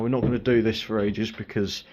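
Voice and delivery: normal talking voice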